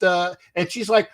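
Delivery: normal talking voice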